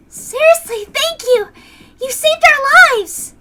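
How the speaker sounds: put-on high, loud, bubbly girl; Rena's voice. Rena thanks the stranger who saved them, high and gushing. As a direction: gush your thanks like an excited young girl.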